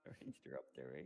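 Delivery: put-on Canadian accent, whispered low with eh; hushed Canadian tease; soft Canadian accent, very low; mock Canadian whisper. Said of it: "you went Canadian there".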